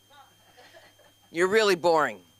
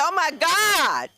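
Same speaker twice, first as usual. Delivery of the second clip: high, loud, drawn-out mock wail; retold panic; 11 semitones higher, 6 dB louder, slower than usual. Comic retelling her scare at the daughter's reply, mock panic. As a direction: play up the fright, loud and slow.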